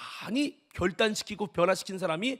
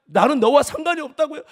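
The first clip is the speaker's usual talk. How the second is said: indignant disbelief; 6 semitones higher, 9 dB louder than usual. Retold or imitated. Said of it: "하나님께 하소연하며".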